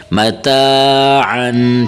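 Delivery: متاعن drawn out very slowly with a full throaty ع; clear ain sound. Shown as a demonstration. Teacher models for students the throaty ع in متاعن. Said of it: "عین کی آواز نکالیں".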